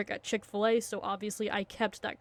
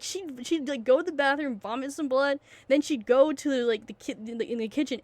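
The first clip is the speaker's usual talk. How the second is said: ordinary talk, nothing marked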